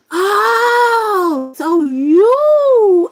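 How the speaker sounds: loud, high, drawn-out dawning surprise; exaggerated realization. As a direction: stretch it out as a big reveal. Acts out own startled realization.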